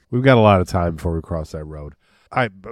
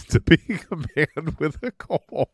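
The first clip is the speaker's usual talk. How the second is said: laughing through the words; laughing joke; 7 semitones higher, slower than usual. Real, not acted. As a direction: crack up while saying it. Joking self-mockery.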